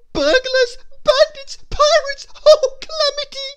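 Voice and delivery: very high, loud squeal of alarm; panicked outcry. A narrator's reading. Mister Jelly calls the police about intruders, panicking. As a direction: shriek each word in panic. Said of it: "calling the police".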